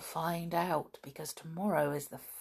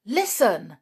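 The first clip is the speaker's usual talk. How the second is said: sudden; 3 semitones higher, 6 dB louder than usual. A narrator's reading. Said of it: "said Julian suddenly".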